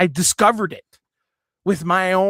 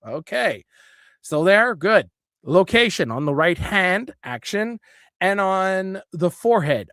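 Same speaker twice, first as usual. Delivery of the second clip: ordinary talk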